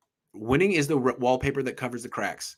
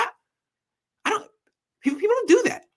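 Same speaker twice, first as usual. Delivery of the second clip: incredulous; faster than usual. Retold or imitated. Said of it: "I was like".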